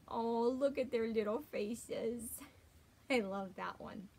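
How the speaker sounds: ordinary delivery, nothing marked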